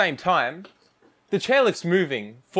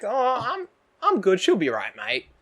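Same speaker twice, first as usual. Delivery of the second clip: put-on Aussie accent; 5 semitones higher than usual. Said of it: "a full-on Aussie accent".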